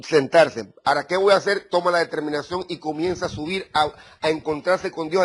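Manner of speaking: usual speaking voice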